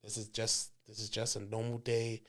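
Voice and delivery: low, very soft murmur; hushed reassurance. Retold or imitated. Best man calming the groom, hushed low reassurance. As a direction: talk him down privately, close to his ear. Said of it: "talking shit in my ear".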